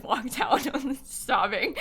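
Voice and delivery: slow words broken by helpless laughter; laughing. Real, not acted. Streamer recalling walking out to mom in the car, laughing. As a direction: laugh through an upsetting memory.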